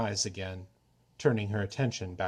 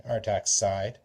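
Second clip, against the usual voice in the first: usual speaking voice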